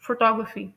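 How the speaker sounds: Photography, stress the second syllable; stress on TOG. Shown as a demonstration. Teacher models the right stress of photography. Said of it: "इसकी right pronunciation है".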